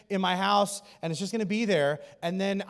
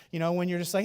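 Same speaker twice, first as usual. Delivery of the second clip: ordinary talk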